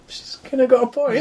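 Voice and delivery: squeaky high, laughing concession; laughing high aside. Retold or imitated. To friends about the daughter, a laughing high-pitched aside. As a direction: giggle it out high. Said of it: "And you're like".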